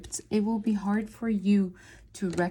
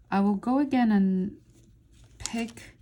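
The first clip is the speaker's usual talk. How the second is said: ordinary talk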